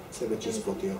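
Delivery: a lightly voiced hushed catchphrase; Chavo del Ocho. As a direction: murmur it like the TV kid, soft and low. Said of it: "el Chavo del Ocho acostumbraba a decir". (imitación suave y baja del personaje)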